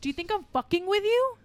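repeating the line. Acted. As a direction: throw it back at him.

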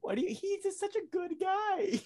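very high, drawn-out mock whine; mock-tearful defence; squeaky pleading. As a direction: plead in a high voice through laughter. Laughing, pleading defence of the actor.

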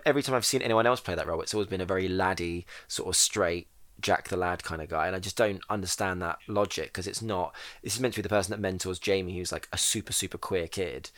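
ordinary delivery

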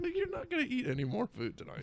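high, soft laughing tease. Real, not acted. Teasing his friend about food, laughing and high. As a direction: giggle it out high.

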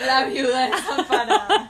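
milk it like a soap opera while laughing; dramatic mock, laughing. Burla de viuda dramática. (burla melodramática entre risas)

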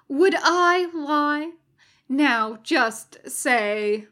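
slow, innocent Would I lie; sly mock innocence. A narrator's reading. Crowley reassuring the Satanists, slow mock innocence. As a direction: play wide-eyed innocence.

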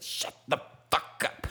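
hushed, mostly voiceless mock shout; strained, breath-only take on a kid's outburst; imitated cursing kid. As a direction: mimic the yell under the breath. Repeats the kid's line, imitating.

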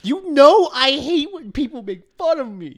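high loud slow whiny falsetto; squeaky falsetto mockery. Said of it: "I don't sound like that".

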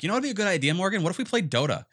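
high, loud, eager pitch to a partner; wheedling suggestion. Retold or imitated. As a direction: coax her like it's a brilliant idea. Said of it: "And I'm like".

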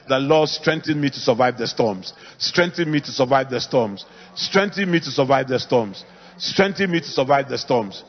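chanting a prayer, loud and steady. Acted. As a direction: pray it out loud, over and over. Leads congregation in loud prayer.

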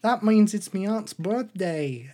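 high, loud mock-Australian bear; comic Australian accent slipping about; wandering Australian accent. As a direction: exclaim in a wobbly Aussie accent. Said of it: "my accent's all over the place".

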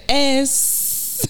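"Es" pitched high, E before a drawn-out S; imitated leading E. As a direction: mimic them putting E first in "Es". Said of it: "they'll be like".

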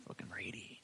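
barely audible whisper to oneself; hushed, voiceless muttered curse; whispered aside. Real, not acted. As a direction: whisper it under your breath. Mutters the spell name aside.

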